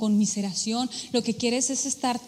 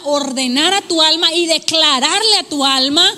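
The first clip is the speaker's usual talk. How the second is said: rising proclamation; 3 semitones higher, 9 dB louder than usual. Acted. Preacher voicing God to the congregation, building to a loud cry. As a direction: build from firm to a loud ringing Dios. God's words rising to climax.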